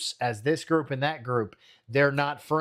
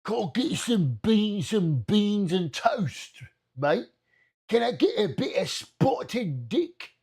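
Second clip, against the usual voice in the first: hammed-up British order at a café, higher; mock British accent; 5 semitones higher than usual. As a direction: ask for beans like a caricature Brit. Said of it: "Fucking stupid Brit bonger".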